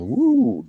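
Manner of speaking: high-pitched "woo"; a quick high whoop of approval; cheering woo. Real, not acted. Cheering pages being done.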